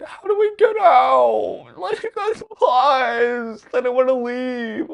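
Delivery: very high, loud, slow wailing plea with a laugh; panicked crying plea. Retold or imitated. To the park worker, begging to get out, a high loud wailing plea. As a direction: wail it like a terrified kid begging to leave.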